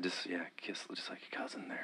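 breathy unvoiced whisper; mock whisper. Hosts playing the cousin love scene, whispering. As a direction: whisper it like a secret cue.

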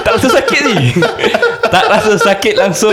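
high-pitched laughter through words; cracking up while talking; laughing. Real, not acted. Laughing at own story.

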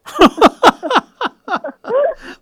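high, loud burst of real laughter; hearty laughter. Real, not acted. Laughing at her friend's retelling of the mix-up, hearty.

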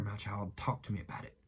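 low, hushed and coaxing; gentle acted voice of a consoling Jesus; tender fatherly invitation. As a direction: soften right down to comfort a child. Jesus voiced tenderly answering the troubled.

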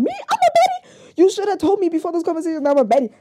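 high, loud squeal of mock surprise, rushed; mock shock. Telling friends she never knew she was a baddie, shocked squeal. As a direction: shriek it like you just found out you're a baddie. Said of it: "And I'm".

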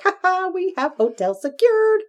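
high-pitched mock gloating; smug chuckle. Gloating to herself about booking hotels early, smug mock chuckle. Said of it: "I just kind of chuckled to myself".

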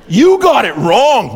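loud, high, drawn-out playful jeer; mock scolding. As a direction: tease the loser at full volume. Mock scolding after wrong answer.

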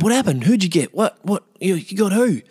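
high, bewildered rapid questions; baffled questioning. Retold or imitated. Punched man questions his friend, baffled. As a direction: act out total confusion, pitched well up.

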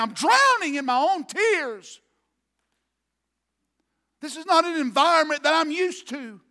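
anguished outcry. Retold or imitated. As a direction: wail it out at full preaching force. Preacher voices David drowning in tears.

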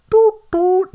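very high loud chime; loud chime imitation. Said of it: "That's also not it".

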